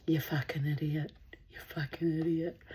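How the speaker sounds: hushed whisper, repeated; whispered insult. Retold or imitated. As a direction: whisper it fondly under your breath. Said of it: "she's like".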